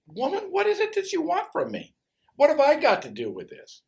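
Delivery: high, loud, exasperated protest; exasperated Jesus. Acted. Jesus pushes back at his mother, exasperated and high. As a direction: throw the question back at her, high and loud.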